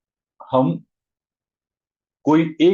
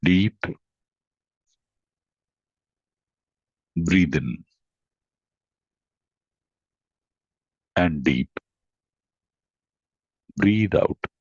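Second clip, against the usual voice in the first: low, slow meditation voice with long pauses; guided meditation; 6 semitones lower, 4 dB quieter, much slower than usual. Real, not acted. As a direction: guide them slowly into deep, even breathing. Leads a breathing meditation.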